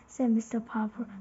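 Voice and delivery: plain reading voice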